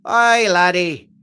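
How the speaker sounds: put-on Irish accent. Streamer answering Crypto Runner in the chat, playful Irish accent. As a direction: play up a stagey Irish brogue. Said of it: "we're Irish today".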